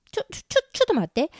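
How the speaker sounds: fast, high stammering objection; flustered protest. Acted. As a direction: stumble over the first word in a panic. Flustered waitress objecting to customer.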